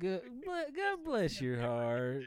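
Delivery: slow, syrupy Southern drawl; Southern bless your heart. Shown as a demonstration. Southerner saying bless your heart, slow and sweetly condescending. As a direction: stretch it out, sweet but cutting. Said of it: "that's how Southerners say you're an idiot".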